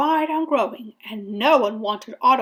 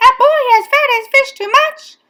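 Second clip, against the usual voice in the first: high, fast, very loud repeat; alarmed exclamation; 14 semitones higher, 10 dB louder, faster than usual. A narrator's reading. Firemen echoing the radio call, high fast shout. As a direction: shout it back in disbelief.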